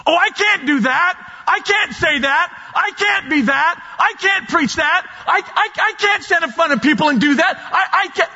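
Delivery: high, fretful imitation of someone refusing God's call; whiny doubter. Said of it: "when you think you can't do it".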